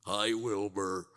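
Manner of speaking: a slow, lightly voiced talking horse; Mister Ed voice. Talking horse greets his owner, lightly voiced. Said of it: "this is like Mister Ed".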